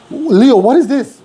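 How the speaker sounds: high, slow puzzled boss; boss's question. Retold or imitated. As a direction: ask it high and slowly, puzzled. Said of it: "And I go like".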